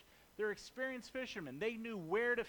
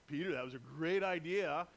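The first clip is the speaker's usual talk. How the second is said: sarcastic disciple; 3 semitones lower, 3 dB louder than usual. Acted. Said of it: "there had to been like".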